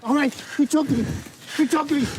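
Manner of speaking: panicked pleading. Real, not acted. Suspect pinned down by officer.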